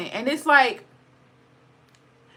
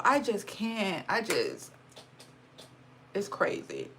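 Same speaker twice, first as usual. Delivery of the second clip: much softer, choked and fading; holding back tears; 5 semitones lower, 9 dB quieter than usual. Real, not acted. Vlogger trying not to cry over people's pain, choked and soft. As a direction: fight the tears, let the voice sink away. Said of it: "I don't wanna cry".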